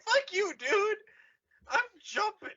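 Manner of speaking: high crying refusal; high desperate yell. Acted. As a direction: wail it out in despair.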